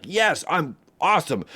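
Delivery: high-pitched, thrilled outburst, retold; imitated excitement. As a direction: burst out like an overexcited winner. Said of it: "He got so excited".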